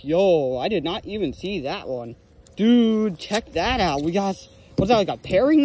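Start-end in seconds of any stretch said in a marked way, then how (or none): none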